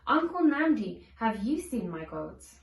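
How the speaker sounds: usual speaking voice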